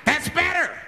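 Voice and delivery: high, forceful exclamation; high-pitched approval. Acted. Flasher to the hushed bus, high-pitched approval. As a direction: call it out high as a mock madman. Man satisfied once bus goes quiet.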